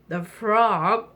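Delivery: ordinary delivery, nothing marked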